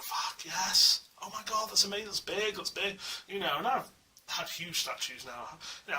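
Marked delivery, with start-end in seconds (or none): 0.0-3.0 high-pitched thrilled exclamations; acted excitement. New collector reacting to a first statue, excited gushing. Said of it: "you're like".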